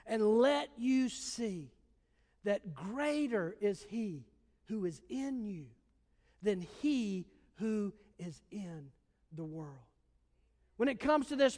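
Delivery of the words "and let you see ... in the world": hushed prayer. Acted. Preacher's hushed closing prayer.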